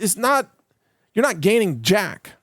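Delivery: ordinary talk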